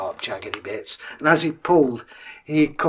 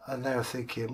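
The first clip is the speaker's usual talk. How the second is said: usual speaking voice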